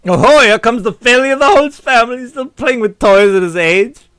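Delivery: high, loud taunt; jeering. Acted. Onlookers mocking the Holtz family failure, jeering. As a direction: jeer at him, drawing it out. Voices guests jeering at Carl.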